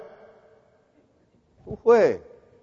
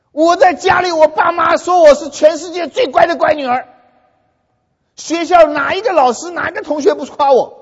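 higher, louder indignant tirade; fierce scolding; 7 semitones higher, 4 dB louder than usual. Retold or imitated. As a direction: scold him like an offended proud girl. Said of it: "凶不凶？真凶哈！".

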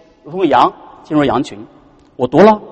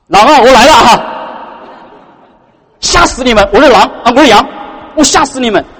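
mock scary wolf; 5 semitones higher than usual. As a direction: pretend to scare the flock, half joking. Playful mock scare as wolf.

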